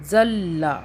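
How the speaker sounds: जल्ला with the l held double; doubled laam. Shown as a demonstration. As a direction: linger on the l in जल्ला before la. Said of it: "फिर लाम की दो मरतबा आवाज़ आ रही है".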